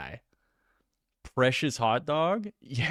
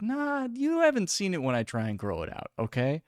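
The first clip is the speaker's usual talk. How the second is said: defensive retort; 7 semitones higher than usual. Retold or imitated. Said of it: "it's like".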